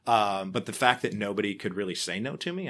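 ordinary talk

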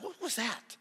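high-pitched astonished question; retold astonishment. Speaker asks friend about the prayer group, astonished. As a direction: ask it high, mock-stunned.

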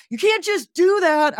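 high, indignant put-on voice; mock roommate protest. Roommate objects to her storming in, high indignant voice. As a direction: play the startled roommate objecting.

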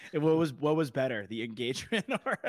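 laughing. Real, not acted. Teasing friend about engagement.

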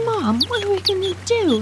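high, pleading appeal to mom; imitating worried son. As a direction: play a son anxiously asking for help. Son holds chick, appeals to mother.

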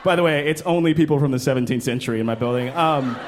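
ordinary talk

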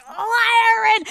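high, drawn-out holler; imitated mom scream. Host imitating friend's mom, constant screaming. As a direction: mimic a mother calling from afar. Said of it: "just constantly screaming".

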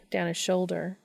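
ordinary delivery

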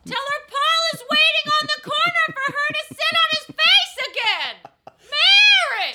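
high shouting town crier call. Pretend. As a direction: bellow it down the street like a crier. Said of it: "the town crier version".

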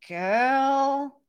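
ordinary delivery

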